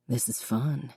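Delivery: soft, hushed low murmur; whispered. A narrator's reading. Connor whispering to Jessica, soft and hushed. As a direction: breathe it softly to her. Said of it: "he whispered".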